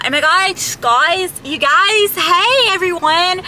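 bubbly high-pitched mock YouTuber greeting; imitating Florida cousin. Mocking how she once copied her cousin's vlog greeting, high and gushing. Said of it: "I used to try to talk like her".

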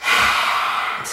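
long breathy exhale through the mouth; lion breath. Shown as a demonstration. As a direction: breathe out the yucky feelings. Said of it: "Lion breath is a great way".

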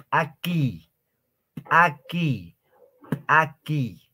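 "Aquí" con la p inicial reventada; p explosiva. Shown as a demonstration. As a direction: haz explotar una p al empezar "Aquí". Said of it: "como si nosotros estaríamos haciendo reventar o explotar algo". ("Aquí" con p eyectiva, como un estallido)

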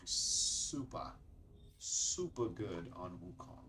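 slow, badly missed German accent; attempted German accent. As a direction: try a German accent, drawing it out. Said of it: "Trying to do a German accent".

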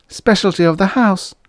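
high-pitched waitress's plug; for the boss's benefit. A narrator's reading. Waitress promotes the dish while boss listens, dutiful selling. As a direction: add it for a listener's ears. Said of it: "she added for the boss's benefit".

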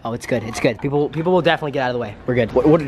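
usual speaking voice

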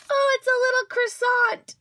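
high, loud squeal of discovery; delighted surprise. Real, not acted. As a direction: squeal at a sweet little surprise. Delighted surprise at the croissant friend.

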